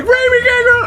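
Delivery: very high, loud name yell; excited scream. Retold or imitated. Answering a call from a friend, excited scream. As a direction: shriek it as a thrilled greeting. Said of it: "I get so excited".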